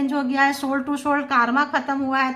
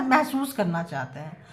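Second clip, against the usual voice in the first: ordinary delivery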